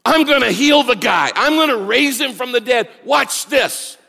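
mock triumphant announcement; mock boastful shout. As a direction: play a show-off Jesus calling out. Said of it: "He doesn't say".

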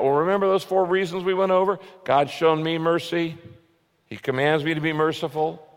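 ordinary talk

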